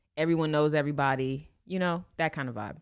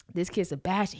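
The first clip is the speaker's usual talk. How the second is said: ordinary talk, nothing marked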